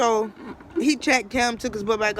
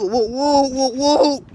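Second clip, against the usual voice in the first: siren imitation; 3 semitones higher, 5 dB louder, much slower than usual.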